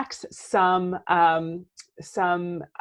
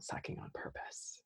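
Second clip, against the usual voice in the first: whisper; 20 dB quieter than usual. Real, not acted. Calm self-reminder.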